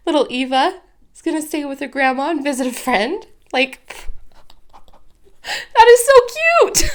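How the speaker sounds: high, loud, tearful laugh-crying; overwhelmed, tears breaking through laughter; crying while laughing. Real, not acted. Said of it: "a postcard from nineteen oh nine just made me cry".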